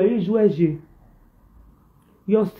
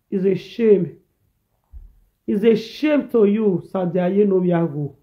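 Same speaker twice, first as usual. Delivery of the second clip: ordinary delivery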